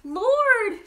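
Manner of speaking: high, loud desperate cry; gasped cry. Acted. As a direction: call out to him in anguish. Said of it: "she gasped".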